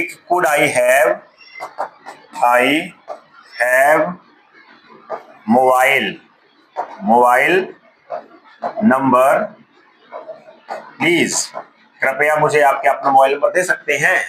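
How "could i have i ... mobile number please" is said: each word spoken apart with long pauses; English request broken up word by word; slow word-by-word model. Shown as a demonstration. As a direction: say it slowly, one word at a time, for learners. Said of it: "जैसे क्या लिखा है, आप मांगने के लिए".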